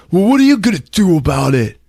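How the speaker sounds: high, loud bratty challenge; rebellious. Retold or imitated. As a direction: talk back defiantly, hammed up. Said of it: "So yeah, rebellious, right?".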